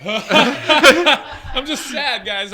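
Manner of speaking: slow, laughing mock sadness. Real, not acted. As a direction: laugh through the words. Laughing while claiming sadness.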